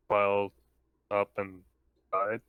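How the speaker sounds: ordinary talk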